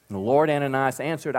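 ordinary talk

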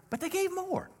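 amazed. Real, not acted. Marveling at giving.

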